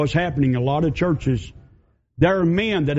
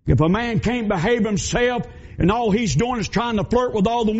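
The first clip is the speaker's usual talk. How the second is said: higher, driving preacher's denunciation; preaching at full force; 4 semitones higher than usual. Acted. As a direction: hammer it home from the pulpit. Preaching climax.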